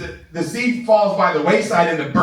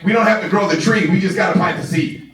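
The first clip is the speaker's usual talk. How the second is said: ordinary talk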